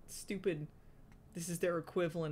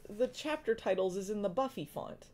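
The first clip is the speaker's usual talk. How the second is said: ordinary talk, nothing marked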